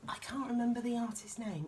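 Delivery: usual speaking voice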